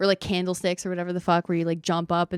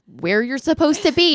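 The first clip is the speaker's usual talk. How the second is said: mock loud guru; 8 semitones higher, 7 dB louder than usual. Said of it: "And he's like".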